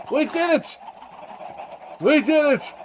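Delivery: high, loud victory yell; triumphant cheering. Real, not acted. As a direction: squeal with triumph. Celebrating success.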